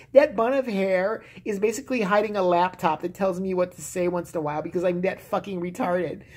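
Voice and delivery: normal talking voice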